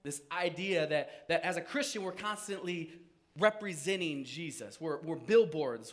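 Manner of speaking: normal talking voice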